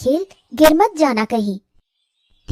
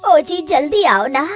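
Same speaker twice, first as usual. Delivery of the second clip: ordinary talk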